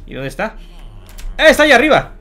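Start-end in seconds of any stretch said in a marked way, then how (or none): 1.4-2.1 high, loud exclamation; surprised shout. Real, not acted. Gamer spots the monster overhead, surprised. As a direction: yell it out on spotting it.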